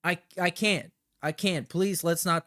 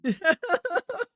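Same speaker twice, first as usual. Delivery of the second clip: real laugh; burst of laughter. Real, not acted. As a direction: crack up at the joke. Laughing at chat's joke.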